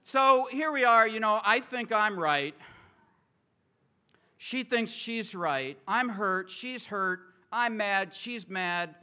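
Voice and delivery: husband hamming up the quarrel; mock heated recap. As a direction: replay the fight with rising heat. Retelling fight with wife, heated.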